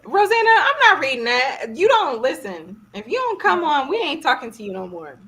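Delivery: high, loud telling-off, playfully put on; playful loud rebuke, easing off at the end; mock scolding. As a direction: scold a friend loudly, half in play. Scolding Rosanna over her comment.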